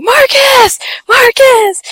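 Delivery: high-pitched mock screams; screaming and crying. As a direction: imitate frantic crying calls after a brother. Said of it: "we're screaming and crying".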